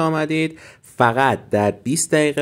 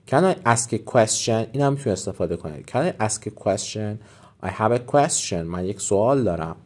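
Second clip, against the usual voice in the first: ordinary talk, nothing marked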